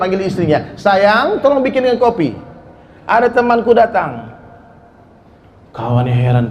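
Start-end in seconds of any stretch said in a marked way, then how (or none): 0.7-4.3 put-on old man calling out. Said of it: "langsung teriak".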